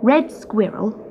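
usual speaking voice

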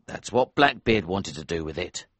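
gentle sincere. A narrator's reading. Elsevier gives diamond, advises.

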